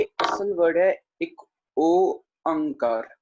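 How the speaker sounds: slow, deliberate pronunciation of एक ओंकार; slow careful demo. Shown as a demonstration. Teacher showing students, slow careful pronunciation. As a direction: draw out एक ओंकार slowly for students. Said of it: "मैं तोड़ के बोल रहा हूँ".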